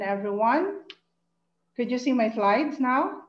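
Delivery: normal talking voice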